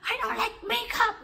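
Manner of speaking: very high, loud shriek; high-pitched furious scream, acted; retold screaming. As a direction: re-enact an enraged mother screaming. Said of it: "She was screaming like".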